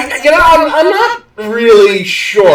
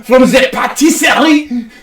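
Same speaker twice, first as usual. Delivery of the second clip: indignant cartoon Frenchman with a thick French accent; mock-French grievance, pitched up; hammy French accent; 3 semitones higher than usual. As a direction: accuse in an exaggerated French accent. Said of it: "Stop the accent!".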